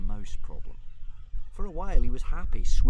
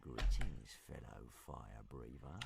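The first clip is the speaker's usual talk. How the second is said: low, soft, slow dragon; sinister hiss; 9 semitones lower, 23 dB quieter, slower than usual. A narrator's reading. Hunting dragon sizing up newcomer Toothless, low soft hiss. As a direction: breathe it out low and menacing. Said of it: "hissed Newt's Breath".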